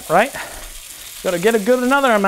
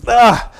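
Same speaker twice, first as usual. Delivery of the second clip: sudden loud yelp at the hot wok; startled yelp; 5 semitones higher, 6 dB louder than usual. Real, not acted. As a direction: yelp out, caught off guard.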